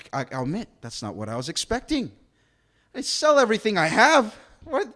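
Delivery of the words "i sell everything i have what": stunned disbelief. Retold or imitated. Ruler stunned repeating command.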